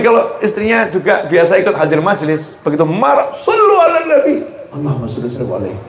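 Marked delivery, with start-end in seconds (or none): 3.4-4.4 high-pitched sudden call. Acted. Wife cuts in on angry husband. 4.7-5.8 low, soft, hurried reply; subdued response. Acted. Husband's reluctant automatic response.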